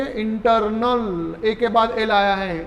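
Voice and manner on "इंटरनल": slow demo. Shown as a demonstration. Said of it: "और एक बार देखेंगे".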